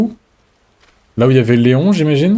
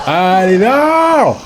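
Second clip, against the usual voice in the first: panicked cry of no; being chased; 7 semitones higher, much slower than usual. Real, not acted. Character caught by enemy.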